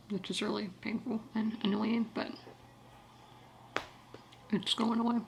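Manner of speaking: ordinary talk, nothing marked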